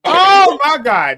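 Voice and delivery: high, loud, slow oh my God; shocked outcry. Real, not acted. Recalling the old lady's fall, a high loud shocked cry. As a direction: cry out in disbelief.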